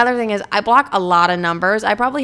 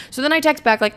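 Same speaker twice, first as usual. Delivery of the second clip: ordinary delivery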